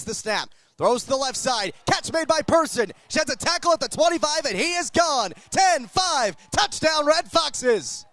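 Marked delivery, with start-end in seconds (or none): 1.9-8.0 touchdown call. Real, not acted. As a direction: build with the run and land the touchdown. Touchdown run called live.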